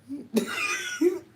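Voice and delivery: thin, very high-pitched laughter; helpless squeaky laugh; high squealing laugh. Real, not acted. Laughing at the Amazon remark.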